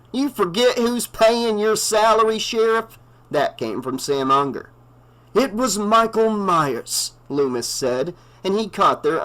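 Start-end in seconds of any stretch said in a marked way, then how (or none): 0.1-3.0 higher, sneering challenge; challenging townie. A narrator's reading. Sam Unger challenges the sheriff, sneering. 5.3-7.1 a slow, raised, ominous doctor's voice; grim old doctor. A narrator's reading. Loomis warns the sheriff, slow and ominous.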